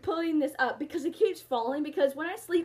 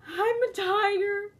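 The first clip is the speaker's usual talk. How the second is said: hammed-up, slow, higher tearful confession; mock dramatic confession; 6 semitones higher, slower than usual. Confessing she's a tiger, mock tearful. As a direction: play it like a tragic reveal.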